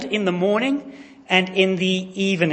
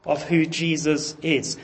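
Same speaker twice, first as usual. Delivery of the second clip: normal talking voice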